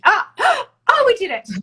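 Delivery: gasping, very high and loud; excited gasp. Real, not acted. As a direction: burst out in thrilled relief. Guest connection finally works.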